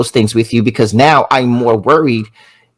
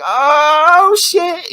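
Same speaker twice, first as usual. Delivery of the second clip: much higher, drawn-out shocked exclamation; stunned reaction. Retold or imitated. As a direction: react as if caught off guard by a compliment. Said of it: "you might be like".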